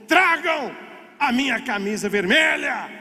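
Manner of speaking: high opening word, commanding call; retold captain's shout. Captain pointing his sword orders the red shirt, shouting. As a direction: cry out the order to the crew. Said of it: "apontou ela pro mar e gritou".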